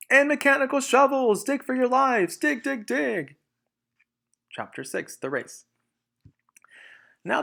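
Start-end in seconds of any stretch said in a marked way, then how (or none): 0.1-3.4 high, loud, in a put-on voice; urgent shouting. Mister Fox urging his family to dig, loud and high. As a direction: drive them to dig for their lives.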